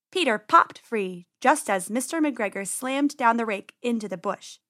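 usual speaking voice

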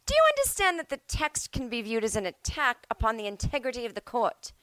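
judge voice. Retold or imitated. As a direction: lean into a different voice for the judge. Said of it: "going wild with voices".

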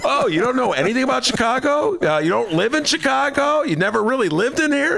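hammed-up naive voter; mock Chicago voter. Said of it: "that's my Chicago voter accent".

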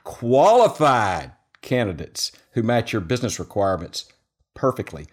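sponsor ad read in a steady pitch voice. Acted. Host reading the LinkedIn sponsor spot to listeners, ad read. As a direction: sell it like a podcast sponsor spot.

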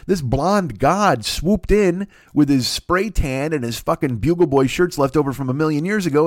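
ordinary talk

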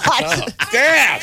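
high exasperated groan; mock groan at pun. Real, not acted. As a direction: groan at the bad joke. Groan at dad joke.

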